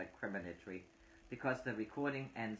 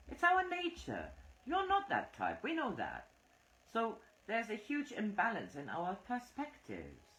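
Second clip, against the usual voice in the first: higher-pitched woman arguing her point; higher indignant wife; Phyllis, higher and insistent; 9 semitones higher than usual. A narrator's reading. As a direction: press the point, voice raised high.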